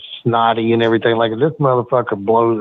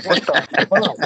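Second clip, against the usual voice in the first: laughing disbelief; 8 semitones higher, slower than usual. Real, not acted.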